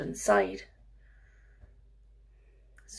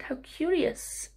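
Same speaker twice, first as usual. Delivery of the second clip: hushed, curious whisper; whispering old man; 7 semitones higher, 5 dB quieter than usual. Acted. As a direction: breathe it like a mysterious old man.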